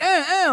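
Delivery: high, insistent "eh" noises; mimicked inarticulate urging, pitched high; imitated wordless grunts. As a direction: grunt for attention without words. Imitating woman who cannot articulate.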